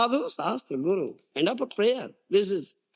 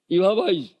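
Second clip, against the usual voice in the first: normal talking voice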